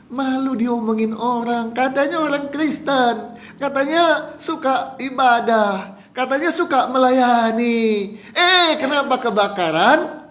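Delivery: high, drawn-out acted complaint; retold aggrieved lament. Victim ashamed of people's talk about him, retold aggrieved lament. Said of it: "Marah nggak dia?".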